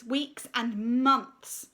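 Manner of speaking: plain reading voice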